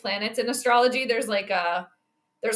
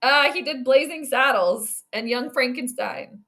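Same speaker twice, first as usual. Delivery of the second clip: ordinary delivery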